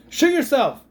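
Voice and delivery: sudden loud, high yell at the screen; fed-up outburst. Acted. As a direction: burst out in exasperation. Fed up, yelling at the movie.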